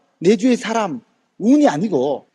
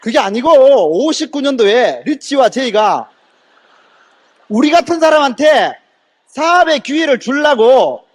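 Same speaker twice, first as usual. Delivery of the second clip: slow, emphatic angry rebuttal; re-enacted heated protest, high and insistent; worked-up anger; 4 semitones higher, 4 dB louder, slower than usual. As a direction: hammer out each phrase, pitch climbing. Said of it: "그래서 제가 열받았어요".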